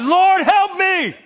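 mock furious prayer, half laughing; angry cry to God. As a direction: cry out to God through gritted teeth. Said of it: "It's a cry because I'm angry".

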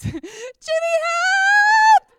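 stretched-out desperate cry; panicked call for help. Retold or imitated. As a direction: yell for your sister as the towel burns. Girl screams for her sister.

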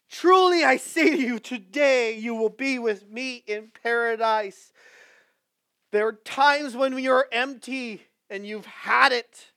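loud, high, drawn-out preacher's cry; full-force preaching. Acted. Pastor crying out to his congregation, loud and high. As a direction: preach it to the rafters.

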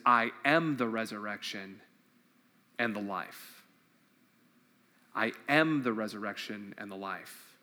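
hushed reverent Jesus line; soft solemn declaration. Acted. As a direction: speak it gently like a promise. Jesus's words said softly.

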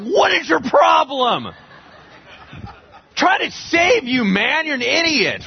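high, slow, loud yell at the rider; exasperated donkey shouting at his master; donkey's outraged shout. Retold or imitated. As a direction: bellow it like a fed-up donkey. Talking donkey yells at Balaam.

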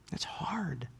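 whispered, breaking off in pain. Real, not acted. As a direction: let it fall to a bare whisper. Painful memory, sermon.

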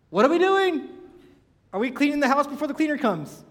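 loud, high-pitched exasperated question; exasperated outburst. Retold or imitated. Husband to his frantically cleaning wife, exasperated.

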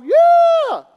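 high, loud, drawn-out whoop; triumphant cheer. Acted. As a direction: cheer like a crowd at the hero's win. Acts out cinema crowd cheering.

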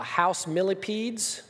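ordinary talk

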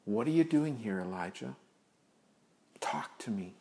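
soft, hushed, tender question fading to a whisper; gentle whisper. Acted. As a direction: coax him gently, barely voiced at the end. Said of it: "he does it with a gentle whisper".

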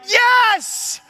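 very high, loud mock cheer; mock cheering yes. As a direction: shout yes like an excited crowd. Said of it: "we're like".

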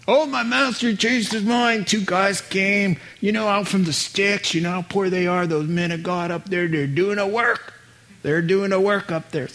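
high-pitched, hammed-up Southern servant; Southern accent. Servant tells Naaman his master changed his mind, in a Southern accent. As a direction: spin a pleading tale in a Southern accent. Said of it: "where the southern accent comes from".

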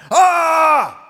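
loud, high, sustained "Ah!"; jump-out scare yell, full voice; startling yell. Retold or imitated. Farm boy jumping out at Herman, startling yell. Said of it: "jumped out and went".

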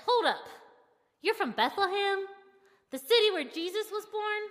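loud, high, starstruck outburst; excited yell. A narrator's reading. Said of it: "I yelled at my Uber driver".

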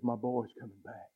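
a hint of a father aching for his son; tender longing father. Father on the porch waiting for his boy, softly hopeful. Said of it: "He says".

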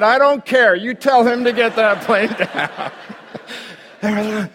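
loud, high and furious, all patience gone; angry shout. Retold or imitated. Pastor yelling at the ticket agent, high and furious. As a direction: bark the order at him.

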